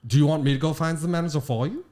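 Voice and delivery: put-on comic German-accented English; mock German accent. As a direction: imitate a German offering help in accented English. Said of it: "opening an authentic German restaurant".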